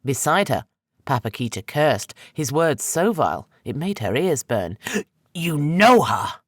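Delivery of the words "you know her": loud, slow, furious accusation; shouting at her in fury; enraged outburst. A narrator's reading. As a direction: hurl it at her in rage. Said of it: "slamming his fist against the wall".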